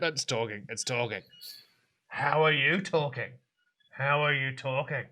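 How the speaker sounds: soft, confiding amazement; quietly baffled old eccentric; awestruck old man. Acted. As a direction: hush it in disbelief. Old man marvels to grandson.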